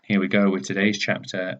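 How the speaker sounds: ordinary delivery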